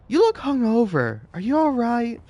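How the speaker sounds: high-pitched mock boss; concerned boss voice.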